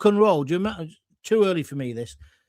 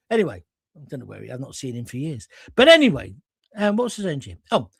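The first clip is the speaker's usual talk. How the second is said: usual speaking voice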